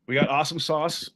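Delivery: usual speaking voice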